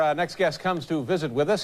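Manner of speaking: ordinary talk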